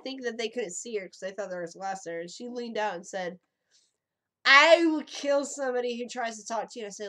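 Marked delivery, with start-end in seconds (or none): 4.4-6.9 put-on loud drunk threat. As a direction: bellow the threat. Drunk friend retold threat.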